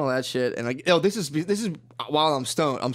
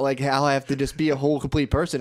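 normal talking voice

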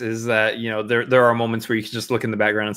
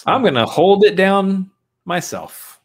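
mock solemn pledge; 8 semitones higher, 4 dB louder, slower than usual. Joking mock announcement.

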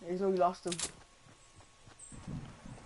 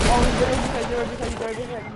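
loud urgent repeated callout; combat callout; 3 semitones higher, 8 dB louder than usual. Real, not acted. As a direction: shout it out as he appears. Spotting enemy in fight.

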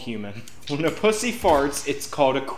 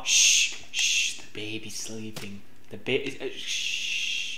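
hushing; 6 semitones lower, 7 dB quieter, slower than usual. Pretend. Hushing over a sleeping baby.

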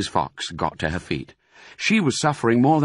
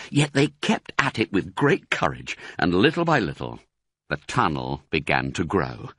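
ordinary delivery

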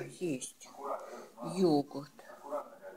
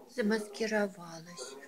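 ordinary delivery

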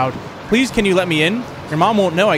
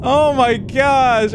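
slow, high breathless exclamation; shaken; 7 semitones higher, 4 dB louder, much slower than usual. Real, not acted. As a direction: still shaking from the scare. Right after jump scare.

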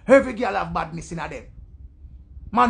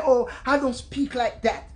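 higher, dainty proper English; mock prim woman; 4 semitones higher than usual. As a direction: imitate a prissy objection. Mimics a shy, stush woman.

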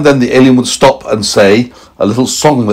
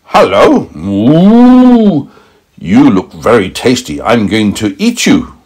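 cow voice; much slower than usual. Acted. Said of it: "So the cow said to the alien".

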